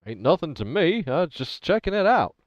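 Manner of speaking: put-on lightly American-accented drifter. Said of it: "he says in a very American accent".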